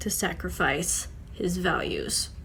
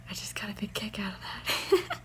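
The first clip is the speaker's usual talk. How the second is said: whisper; 7 dB quieter than usual. Real, not acted. Amused aside after funny story.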